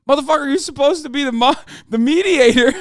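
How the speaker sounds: high, loud, fast laughing protest; laughing outrage. Retold or imitated. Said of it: "I was like".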